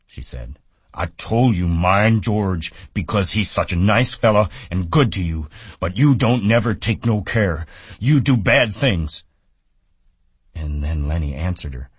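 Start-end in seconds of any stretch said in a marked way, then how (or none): 0.9-9.2 put-on higher, louder, nagging old woman; scolding aunt in Lennie's voice. A narrator's reading. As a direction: scold him like a stern aunt. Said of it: "it was in Lenny's voice".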